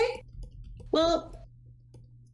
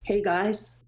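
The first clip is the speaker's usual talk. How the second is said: ordinary talk, nothing marked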